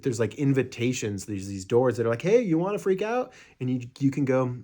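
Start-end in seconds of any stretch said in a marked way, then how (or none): 2.2-3.3 high, loud tempting taunt; teasing invitation. Retold or imitated. A door tempting someone to freak out, high teasing taunt. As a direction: lure them into panicking like a sly voice. Doors inviting you to panic.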